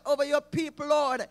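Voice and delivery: ordinary talk, nothing marked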